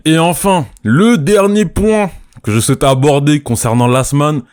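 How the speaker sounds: ordinary delivery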